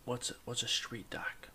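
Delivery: hushed whisper; barely voiced, timid question. Whispered, unvoiced.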